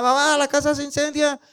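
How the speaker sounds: aguda y urgente, como niña asustada; niña alarmada. Acted. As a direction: grita a mamá con alarma. Niña alarmada despierta padres. (la hermanita avisa a su mamá del incendio, voz aguda y alarmada)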